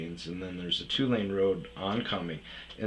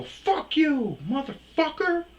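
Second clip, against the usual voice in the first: high, loud mock road-rage yell; shrill retold curse; 5 dB louder, slower than usual. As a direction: yell it back at the honking driver.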